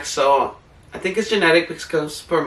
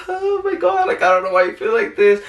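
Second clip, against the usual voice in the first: high-pitched imitation of a tearful wail; mock bawling; 4 semitones higher than usual. Retelling her own crying spells, mock bawling. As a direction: act out bawling, starting very high. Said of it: "I'm started crying, bawling".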